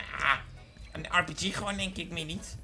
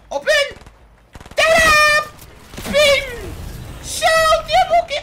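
very high, loud victory yells; excited shriek; 13 dB louder, slower than usual. Real, not acted. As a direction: celebrate loudly. Kill celebration.

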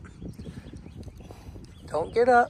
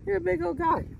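pitched higher, fond sing-song; high pet voice; 7 semitones higher, 4 dB quieter than usual. Real, not acted. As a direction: lift it up high like talking to a pet.

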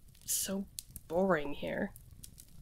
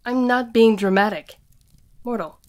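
louder, exasperated rant; frustrated outburst; 5 dB louder than usual. Acted. Witch ranting to the mortal about her yanked license, exasperated. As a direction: vent your frustration at the mortal.

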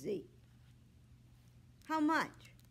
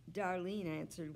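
usual speaking voice

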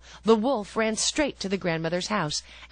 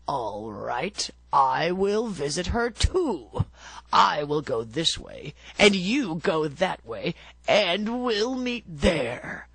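scheming wolf; slower than usual. A narrator's reading. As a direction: plot it like a sly wolf. Wolf voiced in a story.

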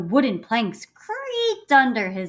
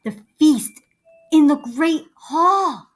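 slow, hushed wonder, louder and higher; awed; 7 semitones higher, 10 dB louder than usual. Acted. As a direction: marvel at the great hall slowly. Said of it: "stared in awe".

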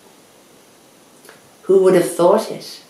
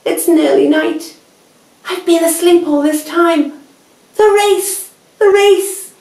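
high, louder, rising panic; panicked Hare; 10 semitones higher, 5 dB louder than usual. A narrator's reading. Hare realising he slept through the race, panicked high yell. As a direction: realise you've lost, building to a yell.

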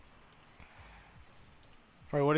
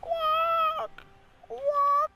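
very high, squeaky silly yelp; squealed mock cry. Gamer teasing teammates mid-match, squealed mock cry. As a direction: put on a tiny falsetto squeal.

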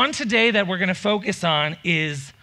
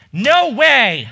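louder, higher pig cry; 5 semitones higher, 7 dB louder than usual. Acted. Second pig refusing the wolf, loud high cry. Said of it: "cried the second little pig".

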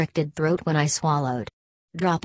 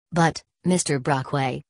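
ordinary delivery, nothing marked

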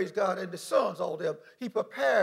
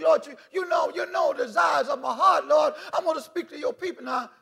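a mildly raised plea to the Lord; retold prayer; 6 semitones higher, 4 dB louder than usual. Preacher retells praying before the sermon, mildly raised. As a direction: call up to God, pleading. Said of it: "I asked God".

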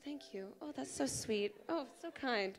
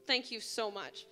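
ordinary delivery

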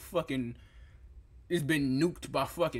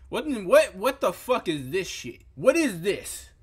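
shocked outburst; 5 semitones higher, 5 dB louder than usual. Real, not acted. Shocked at a food picture.

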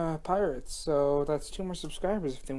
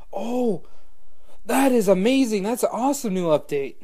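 excited; 6 semitones higher, 6 dB louder than usual. Real, not acted. Delighted by update.

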